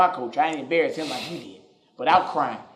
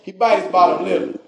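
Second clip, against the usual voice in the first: louder and higher eager telling; excited telling; 7 semitones higher, 8 dB louder than usual. Real, not acted. Comedian hyping how his angry dad looks, loud and excited. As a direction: push it out like a big reveal.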